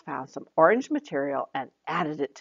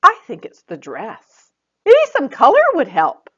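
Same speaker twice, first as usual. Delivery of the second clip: higher, louder bright helpful fairy friend; Joy the friend; 8 semitones higher, 9 dB louder than usual. Acted. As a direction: offer the idea cheerfully. Joy, voiced in the story.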